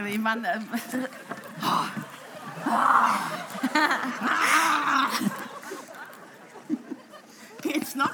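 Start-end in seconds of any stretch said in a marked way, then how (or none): 0.6-6.0 long laugh. Acted. Long laugh during embrace.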